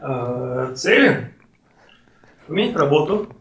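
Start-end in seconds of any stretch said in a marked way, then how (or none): none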